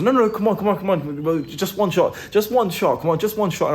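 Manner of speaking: high, repetitive mock begging; insistent pleading. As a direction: imitate friends badgering someone into a shot. Friends retold begging for one shot.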